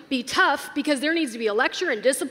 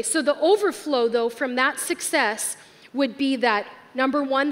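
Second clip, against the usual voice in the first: ordinary talk, nothing marked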